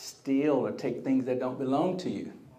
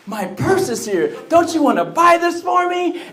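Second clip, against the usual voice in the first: hammed-up thrilled wife, high and loud; excited; 13 semitones higher, 11 dB louder than usual. Wife asks husband to buy her purse, excited. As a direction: plead with loud, high-pitched delight. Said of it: "She felt so much better when her purse came in".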